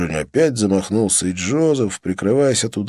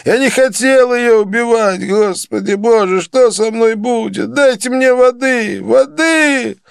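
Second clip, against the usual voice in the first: high, loud, slow weeping plea; sobbing plea; 13 semitones higher, 5 dB louder than usual. A narrator's reading. Joseph sobs to his beaters, begging for water. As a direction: sob and beg for water. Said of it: "Джозеф разрыдался".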